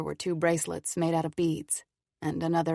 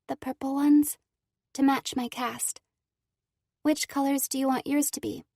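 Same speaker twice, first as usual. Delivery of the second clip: put-on higher, gentle girl voice; young girl voice; 8 semitones higher than usual. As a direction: speak as a sweet girl. Cora's girl voice.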